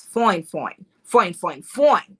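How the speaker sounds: "foin" drawn out with a rounded vowel for "fine"; playful "foin". Real, not acted. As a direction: say "foin" like a slangy "fine", repeated with relish. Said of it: "my husband is foin".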